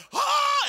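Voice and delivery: very high, loud mock scream; imitated passengers' scream. Preacher imitating everybody on the jolting plane, screaming. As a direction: shriek like a startled planeload.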